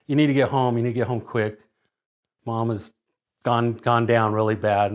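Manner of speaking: low, softened retold phone call; grave and quiet, voice sinking; hushed urgent news. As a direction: relay bad news low and subdued. Retold phone call about dying mother.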